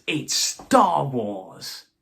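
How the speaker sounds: drawn out very slowly and loudly, savoring each word; excited proclamation. Real, not acted. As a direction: announce it like it needs no defense. Title repeated as excited exclamation.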